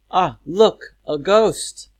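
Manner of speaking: high, loud acted fright; mock scared. Teacher acts seeing a ghost for pupils, mock scared. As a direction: cry out as if frightened, hammed up. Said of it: "because you're so scared".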